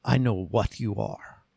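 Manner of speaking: low, quiet action-hero retort; Van Damme impression. Hero to the arrested villain, low, quiet one-liner. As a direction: answer low and soft, coolly unimpressed. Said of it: "And Van Damme goes".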